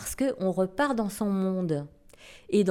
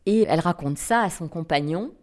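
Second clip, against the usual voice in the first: ordinary delivery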